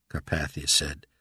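usual speaking voice

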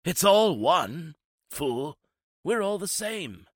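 high, slow and fading far away; a voice trailing after them; eerie demon voice. A narrator's reading. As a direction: demon calling after the fleeing men. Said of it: "The voice of Wu pursued them".

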